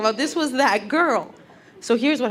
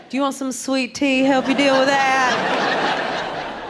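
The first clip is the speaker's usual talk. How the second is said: higher Southern lilt, drawn-out ending; comforting Southern accent; 4 semitones higher, slower than usual. Retold or imitated. Southern nurse offers sweet tea to patient, comforting Southern accent. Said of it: "right Southern accent. It's so comforting".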